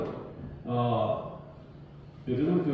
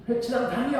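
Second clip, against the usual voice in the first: high mock jeer; mock insult; 8 semitones higher, faster than usual. Preacher retelling Paul's insult to the congregation, jeering. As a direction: toss the insult playfully. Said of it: "하고 욕을 했다고".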